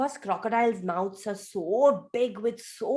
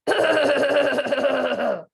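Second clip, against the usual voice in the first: loud rasping crocodile hack; loud croc throat noise; loud throaty hacking noise; 2 semitones higher, 5 dB louder than usual. Shown as a demonstration. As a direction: clear the throat hard like a croc. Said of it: "make a noise like this".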